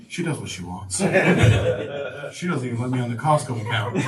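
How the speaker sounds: lightly voiced worn-out dad giving up; mumbled, resigned husband aside; beaten broken man. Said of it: "beaten, broken man that's like".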